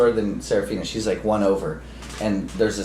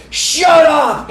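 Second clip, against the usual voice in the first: sudden high, loud yell of shut up; a drunk guy yelling at a little girl; 7 dB louder than usual. Retold or imitated. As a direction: bark it like a drunk losing his temper. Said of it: "he goes".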